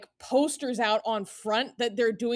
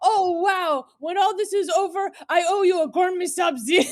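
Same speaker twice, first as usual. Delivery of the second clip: put-on excited kid voice; 7 semitones higher, 7 dB louder than usual. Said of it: "He goes".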